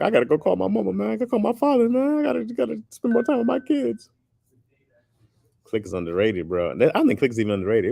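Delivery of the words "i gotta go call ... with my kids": high, tearful plea, voiced for the movie; choked-up emotional voice. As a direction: lightly play someone moved to tears. Click retold as tearjerker.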